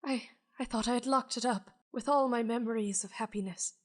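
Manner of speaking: remember a lost happiness, halting; haunted man asking the spirit about the trinket; lightly wistful old man. Acted. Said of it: "and says".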